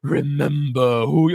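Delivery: slow, drawn-out intoned quote; solemn movie quote. Retold or imitated. Host quotes the Lion King to co-host, slow intoned imitation. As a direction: stretch the words out like a voice from above. Imitates the film's famous ghostly line.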